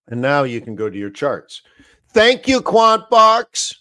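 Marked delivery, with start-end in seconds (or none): 2.1-3.7 higher and louder, bright thank-you; cheerful shout-out to the software; cheery thanks. Real, not acted. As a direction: sing out thanks to the tool. Thanking the tool, calling out.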